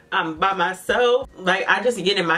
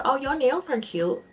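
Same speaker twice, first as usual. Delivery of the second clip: bright friendly compliment, higher; 7 semitones higher than usual. Retold or imitated. Customer complimenting the clerk's nails, sweetly friendly. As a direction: compliment her nails to soften things. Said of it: "take a step back".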